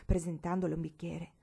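ordinary delivery, nothing marked

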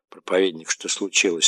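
usual speaking voice

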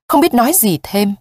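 plain reading voice

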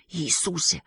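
breathless whispered cry; whispered exclamation. Acted. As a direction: gasp it out in a whisper. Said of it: "вскричал Март". (испуганный шёпот)

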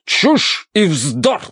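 spluttering colonel. A narrator's reading. Colonel rejects talk of spirits, spluttering. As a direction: splutter with indignation. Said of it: "забрызгал слюной полковник".

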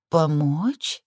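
dreamy, lazy question; lazy drawl. A narrator's reading. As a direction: ask it half-dazed and drowsy. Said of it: "В голосе её послышалась ленца".

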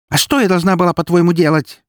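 high, loud, angry comeback; heated, high-pitched retort; snapped retort. A narrator's reading. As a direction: fire back indignantly. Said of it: "рявкнула она в ответ".

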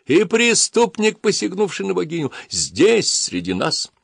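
high, slow ranting shout; yelling priest. Acted. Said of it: "снова завопил жрец".

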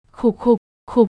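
voiced choking cough, in short bursts; acted coughing. A narrator's reading. Daughter choking on sips of water, acted coughing. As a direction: cough out in separate spurts. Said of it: "Thấy nó ho".

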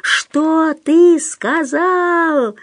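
very slow, high drawn-out demand; stretched, high-pitched fury; outraged yell. A narrator's reading. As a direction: wail every word out furiously. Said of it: "заорал Пётр".

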